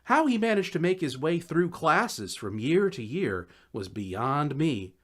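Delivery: ordinary delivery, nothing marked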